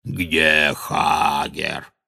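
very slow, low growled question; deep, dragging monster voice; giant's growl. A narrator's reading. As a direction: demand it ponderously like a giant. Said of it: "прорычал Грох".

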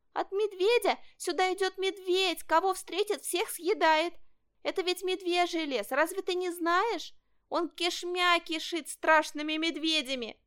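high, urgent little fox voice; alarmed fox cub. Acted. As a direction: warn of danger in a high, excited voice. Fox cub warns the boy. (встревоженный лисёнок, высоким голосом)

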